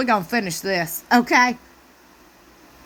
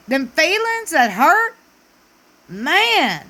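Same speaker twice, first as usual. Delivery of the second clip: ordinary delivery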